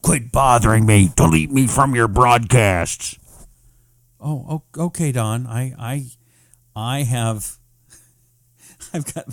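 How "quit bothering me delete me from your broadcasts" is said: loud, irritated read of a viewer's comment; louder mock-angry reading of a viewer's complaint; annoyed demand. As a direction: bark it out like a fed-up viewer. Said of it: "Dawn says".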